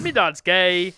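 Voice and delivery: loud exaggerated British echo; mock British accent. Echoing the friend's joke, loud mock British accent. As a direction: echo it in broad put-on British. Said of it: "making fun of accents".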